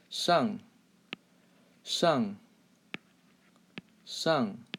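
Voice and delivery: shang in a sharply falling fourth tone; fourth-tone demo. Shown as a demonstration. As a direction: say "shang" with a falling pitch, curled-tongue sh. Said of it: "So fourth tone".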